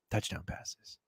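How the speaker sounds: breathy whispered aside. Real, not acted. Streamer whispering a bet idea to chat. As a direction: whisper it like a secret.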